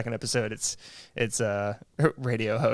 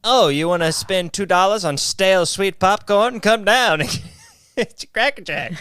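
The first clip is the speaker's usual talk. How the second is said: higher, louder carnival barker; old-timey vendor; 6 semitones higher, 4 dB louder than usual. Pretend. Vendor hawking stale Cracker Jack in a loud, high old-timey barker voice. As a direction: hawk it like an early 1900s vendor.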